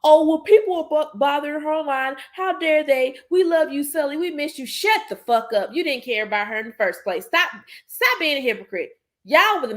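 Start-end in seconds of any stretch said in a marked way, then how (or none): 0.0-4.7 higher mock sympathy voice, sarcastic; sarcastic impression of hypocritical fans; mocking K-Nets. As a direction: parrot their fake concern in a raised, whiny voice. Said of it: "had the nerve to say".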